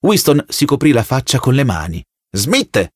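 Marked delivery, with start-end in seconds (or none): 2.3-2.9 restrained shout, a sharp falling call; shouted command. A narrator's reading. Telescreen voice calls a prisoner to order, mildly shouted. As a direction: call out the surname as an order, without much volume. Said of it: "gridò la voce dal teleschermo".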